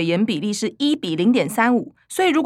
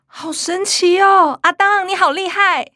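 gasp of amazement; 7 semitones higher, 4 dB louder than usual. Acted. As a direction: marvel at grandma's trick. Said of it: "众人惊呼".

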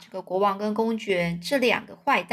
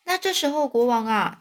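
ordinary delivery

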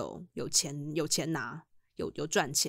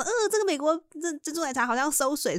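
higher, put-on voice full of disgust; mock disgust; 9 semitones higher than usual. Calling the American tea swill, high put-on disgust. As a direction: sneer at the drink, half joking.

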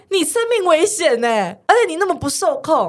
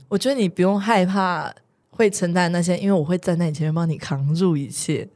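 warm reassurance; 7 semitones lower than usual. Shown as a demonstration. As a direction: reassure her like you mean it. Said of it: "有爱吗？".

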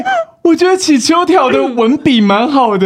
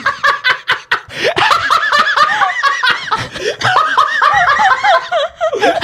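big laugh. Real, not acted. Host laughs at insult.

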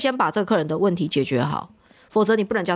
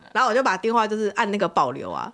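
ordinary delivery

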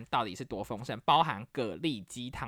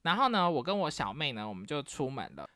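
ordinary talk, nothing marked

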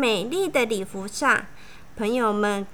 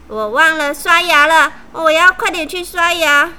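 loud hasty excuse; flustered excuse; 3 semitones higher, 7 dB louder than usual. A narrator's reading. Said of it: "她的朋友们看了就吓到了".

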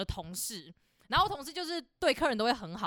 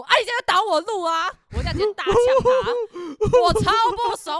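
loud, much higher, slowed angry yell; 11 semitones higher, 12 dB louder than usual. Retold or imitated. The cashier yells back at the rude customer, furious. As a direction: yell it right back at him.

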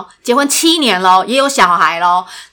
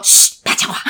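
whispered hush; 4 dB quieter than usual. Retold or imitated. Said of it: "他会叫我说".

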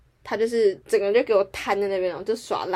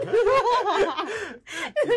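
high rising laugh; 12 semitones higher than usual. Real, not acted. Laughing at fried rice story.

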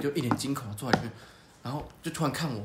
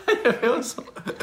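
much louder, high laugh-talk; 10 semitones higher, 8 dB louder than usual. Real, not acted. Joking that the old man left his car unlocked, laughing high. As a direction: laugh through the words.

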